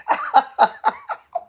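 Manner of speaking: shrill burst of laughter; a very high delighted giggle. Real, not acted. Laughing at a viewer comment.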